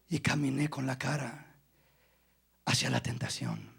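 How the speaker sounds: preacher softly voicing a repentant sinner; soft confessional testimony. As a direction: speak it as a quiet confession.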